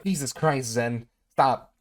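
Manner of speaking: startled loud shout; scared horror game streamer. Real, not acted. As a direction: yell out in real fright.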